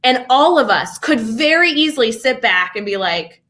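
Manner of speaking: ordinary delivery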